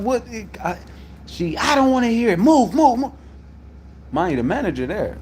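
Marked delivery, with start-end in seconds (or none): 1.6-3.1 high, loud shooing command; retold yelling. Dispatcher shouting him away from her desk, loud and high. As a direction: mimic her telling him to move. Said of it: "she yelling".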